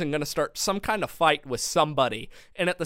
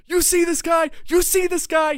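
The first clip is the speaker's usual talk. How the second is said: high-pitched, insistent mock holler; emphatic; 13 semitones higher, 5 dB louder than usual. Friend pointing someone out to the waitress, imitated emphatic holler. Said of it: "my friend emphatically".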